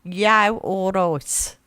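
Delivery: slow, drawn-out Brummie greeting; Birmingham accent. Shown as a demonstration. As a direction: stretch it out in broad Brummie. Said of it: "I'll say it again".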